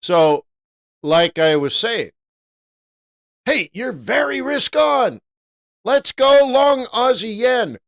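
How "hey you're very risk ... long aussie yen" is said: high, loud, hammed-up enthusiasm; mock gung-ho trader. Trader acting out going long Aussie yen, mock gung-ho. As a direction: call it out as an overeager trader. Voices an overeager risk-on trader.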